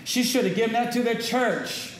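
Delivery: mock Judas. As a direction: play Judas complaining about the money. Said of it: "And he's like".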